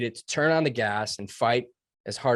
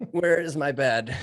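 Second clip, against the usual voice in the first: slow, higher mock-weary plea with laughter; imitated weariness; 4 semitones higher, 3 dB louder, slower than usual. Worn-out trainee after Hell Week wants sleep, comically weary. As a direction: laugh through a drained, dragged-out question. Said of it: "your body doesn't wanna move another inch".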